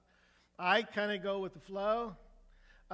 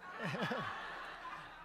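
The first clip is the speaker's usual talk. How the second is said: laugh; 6 dB quieter than usual. Real, not acted. Laugh after the punchline.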